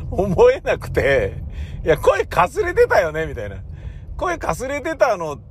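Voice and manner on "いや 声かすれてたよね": high-pitched incredulous comeback; playful inner heckling; mock retort. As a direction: call out the excuse, voice pitched way up. Acted inner retort to monk.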